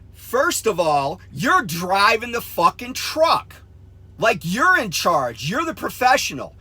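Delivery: loud, emphatic dressing-down; retold angry scolding. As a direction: lay into the trainee, re-enacted. Retold scolding of the trainee driver.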